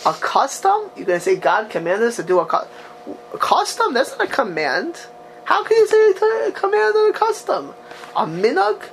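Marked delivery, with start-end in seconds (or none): 0.1-0.9 high-pitched mock disbelief; incredulous question. Teacher challenges a blessing on custom, incredulously. 3.3-5.0 high-pitched mock disbelief; incredulous question. Teacher challenges a blessing on custom, incredulously. 5.4-8.8 pitched way up in mock disbelief; incredulous objection. Teacher voices the commentary's objection, incredulously.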